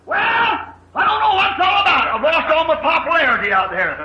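high, shouted complaint; mock outburst of someone losing patience; flying loose. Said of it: "He didn't fly loose and say".

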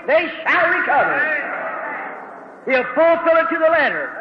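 an evangelist thundering about God's promise; full-force preaching. Real, not acted.

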